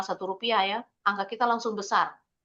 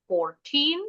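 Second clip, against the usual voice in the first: FOURTEEN with the second syllable pitched up; higher tone on TEEN; 7 semitones higher than usual. Shown as a demonstration. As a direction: lift TEEN in FOURTEEN above the first syllable. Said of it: "tinnya lebih tinggi intonasinya". (FOURTEEN, nada "teen" lebih tinggi)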